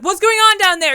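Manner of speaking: high, loud mom calling down. Retold or imitated. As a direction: call down like a worried mom. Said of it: "Your mom's like".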